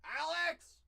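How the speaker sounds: shout. Real, not acted. Calling out to Alex.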